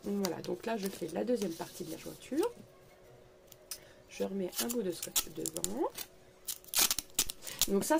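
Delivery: ordinary talk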